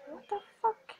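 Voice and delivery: high-pitched, loud disbelief; shocked outburst. Real, not acted. Gamer thrown by what just happened, shocked. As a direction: blurt it out high in real shock.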